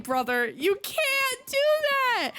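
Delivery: high, slow, squealing laugh through the words; cry-laugh in disbelief; laughing protest. Retold or imitated. Streamer reacting to the baby girl request, laughing. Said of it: "and I'm like".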